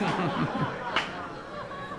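high, airy chuckle; chuckling. Real, not acted. Performer to audience after the buzzer bit, chuckling. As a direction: chuckle at your own joke.

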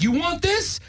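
high-pitched imitation of mom; mock-offer it like a threatening mother; high, put-on mom voice; mom's voice. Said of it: "she'd be like".

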